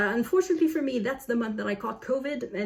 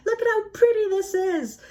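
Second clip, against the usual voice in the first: delighted; 12 semitones higher, 6 dB louder than usual. Real, not acted. Showing off expensive book.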